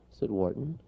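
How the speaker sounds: plain reading voice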